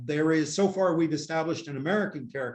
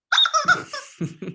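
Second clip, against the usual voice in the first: real laugh breaking out high; high burst of laughter; 5 dB louder than usual. Real, not acted. Laughs at own joke.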